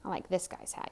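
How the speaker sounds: ordinary delivery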